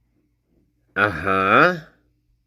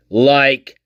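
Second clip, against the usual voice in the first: usual speaking voice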